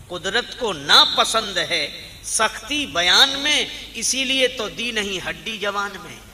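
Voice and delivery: loud, ringing couplet recital; preacher reciting poetry at full voice; verse declamation. Acted. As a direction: declaim the verse with stretched, forceful phrases. Recites the poet's couplet forcefully.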